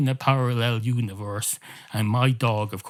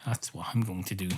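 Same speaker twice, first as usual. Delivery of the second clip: whispering; 3 semitones lower, 7 dB quieter, faster than usual. Acted. Guide dog calms himself down.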